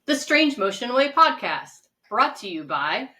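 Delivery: slow, loud show announcer. Acted. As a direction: boom out the show name like a radio host. Podcast sponsor announcement.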